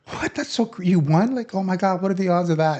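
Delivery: hammed-up party guests gasping in disbelief; mock amazed. Party guests react to the lottery win, amazed. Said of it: "everyone at the party is like".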